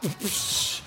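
hissed "psh" of water; imitated hose spray. Dad keeps hosing his son, spray noise. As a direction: mimic the relentless jet.

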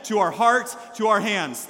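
loud crowd-leading chant; rally the room to repeat it, punching each part; big call-along delivery. Real, not acted. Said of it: "One more time".

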